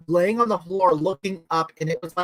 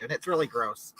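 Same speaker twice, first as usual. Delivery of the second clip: ordinary talk, nothing marked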